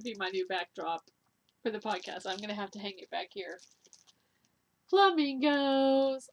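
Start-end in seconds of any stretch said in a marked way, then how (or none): 4.9-6.3 higher, louder, slow drawn-out announcement; excited reveal. Real, not acted. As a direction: unveil it like a big surprise. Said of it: "I know, you're surprised".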